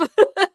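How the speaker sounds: high loud laugh. Real, not acted. Host laughing when students tell her of too many leads, loud high laugh. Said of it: "I laugh".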